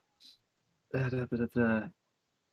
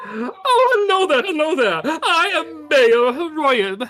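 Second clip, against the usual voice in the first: high, loud, giggly host; beaming mayor welcoming visitors; jolly mayor; 12 semitones higher, 6 dB louder than usual. Acted. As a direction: fuss over the guests with glee.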